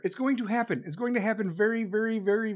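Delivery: ordinary delivery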